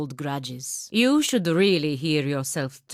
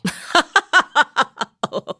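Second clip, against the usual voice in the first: loud mocking laugh, higher; scornful laugh; 11 semitones higher, 6 dB louder than usual. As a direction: laugh him off with open scorn.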